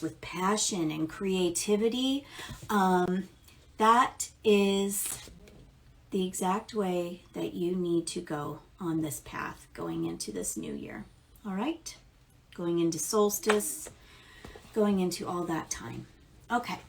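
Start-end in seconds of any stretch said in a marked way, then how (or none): none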